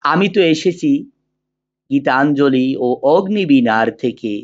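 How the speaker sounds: measured, sustained poetry recital with drawn-out phrase endings; poem recitation. Acted. Teacher reciting the poem Amar Porichoy for students, in measured declamation. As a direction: declaim the verse slowly and evenly, pausing between couplets.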